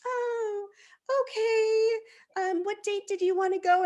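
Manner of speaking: high, unhurried ticket clerk; slow clerk voice. Retold or imitated. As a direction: play the clueless clerk, high and easy. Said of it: "And she's like".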